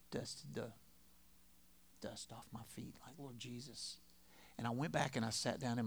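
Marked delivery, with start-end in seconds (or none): 0.1-4.0 very soft, heavy-hearted telling; subdued sadness. Retold or imitated. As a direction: let it sink almost to nothing. Recalls walking away in deep sadness.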